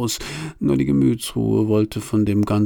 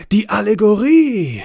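much higher and louder, a dramatic proclamation; grand announcement; 12 semitones higher, 6 dB louder than usual. A narrator's reading. Narrator heralding the Allegory's entrance, grandly excited. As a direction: herald her arrival like a fanfare.

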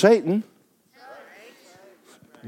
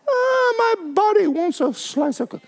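whiny craving voice; 12 semitones higher than usual. Retold or imitated. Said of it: "I'm going".

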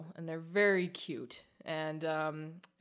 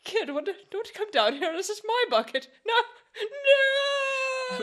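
squeaky lizard voice, much higher and louder, laughing through it; 10 semitones higher, 6 dB louder than usual. Pretend. Podcast host voicing a lizard in a bucket, high laughing squeak. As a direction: play a fussy little lizard shooing a kid out of his bucket, cracking up.

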